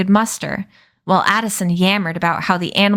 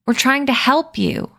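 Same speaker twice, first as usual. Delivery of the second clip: higher, falling firmly on help; outraged repeat; 5 semitones higher than usual. Acted. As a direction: insist it again, flatly offended. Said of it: "he said again".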